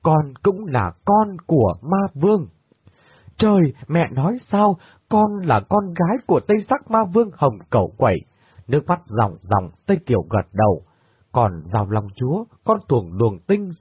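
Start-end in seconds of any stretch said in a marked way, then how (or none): 3.4-8.2 higher cry of stunned disbelief; a daughter reeling, voice lifted in shock. Acted. Daughter learning her father is the demon king, stunned and higher.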